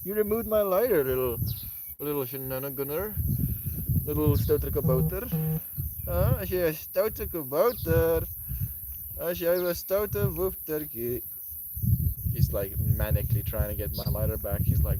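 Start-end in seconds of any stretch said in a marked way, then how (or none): 0.0-1.0 high-pitched mock accusation; playful pet scolding. Streamer to pet who took the lighter, playful scolding. 1.0-11.2 playful pet talk. Pretend. Streamer to naughty little pet, playful pet talk.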